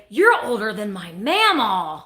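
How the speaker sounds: normal talking voice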